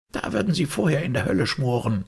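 high-pitched venomous refusal; defiant. Acted. Maid refuses Holmes's bribe, high and defiant. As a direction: curse the detective indignantly.

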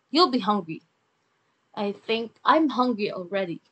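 ordinary talk, nothing marked

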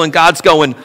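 ordinary delivery

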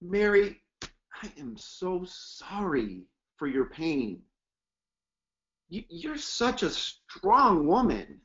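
quiet, slow condolence; soft sympathetic. Shown as a demonstration. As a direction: comfort a grieving woman gently. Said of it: "get amped up".

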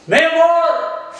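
high, carrying call to the next room; shouted call for Mamacita. Real, not acted. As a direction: call her from across the house. Calling the wife from another room.

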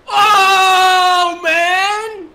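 lamento gritado, agudo y alargado; grito de frustración. Real, not acted. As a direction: chilla al fallar, con tono muy alto. Fallo repentino en el juego. (grito agudo de fastidio)

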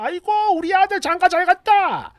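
a husband mimicking his mother's bragging; bragging mother-in-law. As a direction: brag like a proud mother-in-law. Mother-in-law bragging, quoted within quote.

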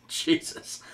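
breathy laughing Jesus under breath; laughing whisper. Real, not acted. Laughing at own slip.

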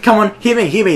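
high, loud bully imitation; excitedly imitated schoolyard dare; goading taunt. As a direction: dare him to throw the punch. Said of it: "like, mocking him".

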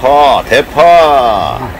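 slowly stretched call; drawn out. Real, not acted. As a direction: stretch the words out long. Calling out ingredients while cooking.

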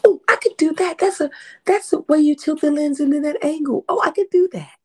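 high, loud stage whisper of discovery; acted-out thrilled realization, pitch jumping way up; excited self-talk. Spotting camera tricks she can do, excitedly talking to herself. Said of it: "quietly to myself".

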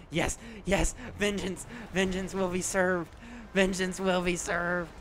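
hammed-up vengeful shout; triumphant battle cry. Real, not acted. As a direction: declare it like an avenging hero. Triumphant after getting target.

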